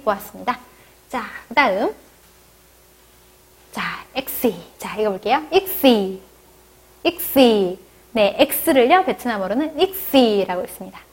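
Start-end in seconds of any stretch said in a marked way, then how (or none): 5.5-7.8 익시 as "ik-si" with a light tense s; Vietnamese X name. Shown as a demonstration. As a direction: model 익시 with a short ㅆ sound. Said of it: "약하고 짧게 발음하는 쌍시옷".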